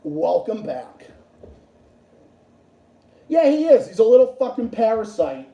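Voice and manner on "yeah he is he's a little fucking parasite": ordinary delivery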